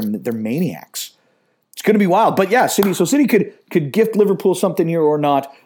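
ordinary talk

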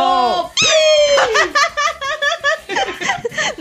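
shrieking laugh. Real, not acted. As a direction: explode into laughter. Bursts out laughing.

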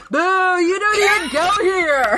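boo like a bratty heckler; mock heckler. Cat heckling a speech in a high mock boo. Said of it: "Pippin's in the background going".

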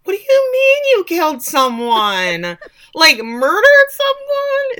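high, slow, loud incredulous question; hammed-up stunned husband; shocked disbelief. Retells husband learning of killing.

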